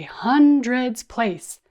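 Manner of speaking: big slow classroom chant; bigger voice. Shown as a demonstration. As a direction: boom it out slowly, the biggest number. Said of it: "we will say it in a bigger voice".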